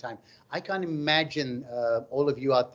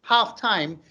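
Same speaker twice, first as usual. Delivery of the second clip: usual speaking voice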